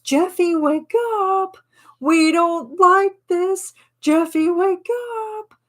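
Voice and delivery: high, pleading, sing-song calls to wake Jeff up. Pretend. Friends pleading with Jeff to snap out of it, high sing-song. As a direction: coax him back like a worried friend. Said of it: "we're like".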